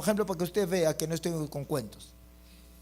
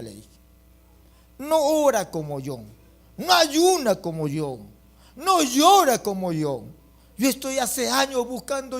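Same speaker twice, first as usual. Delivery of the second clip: mild indignant complaint to God; 8 semitones higher, 4 dB louder than usual. Retold or imitated.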